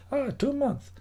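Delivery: lightly imitating the neighbour's Eastern European accent. Streamer quoting the downstairs neighbour, mild Eastern European accent. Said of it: "He's from Eastern European, a guy, he has an accent".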